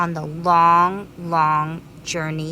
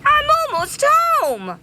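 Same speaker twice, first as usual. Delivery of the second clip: very high, bright squeal; excited bee; 4 dB louder than usual. Acted. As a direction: burst with excitement. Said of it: "cried the greedy bee excitedly".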